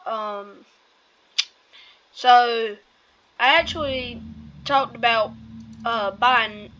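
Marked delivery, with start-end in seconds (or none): none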